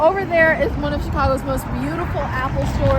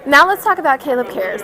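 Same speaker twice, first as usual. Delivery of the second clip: ordinary talk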